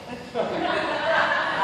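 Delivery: soft unvoiced high giggle; breathy high chuckle; airy giggle. Real, not acted. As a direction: giggle at your own pun. Laughs at own joke.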